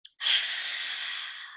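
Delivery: long unvoiced breathy exhale, tongue out; lion's breath exhale. Shown as a demonstration. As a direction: let the breath rush out with a sigh. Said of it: "So you go".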